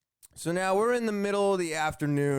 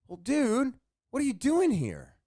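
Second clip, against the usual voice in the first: exasperated retort; 8 semitones higher than usual. Retold or imitated. Exasperated retort to drunk neighbor.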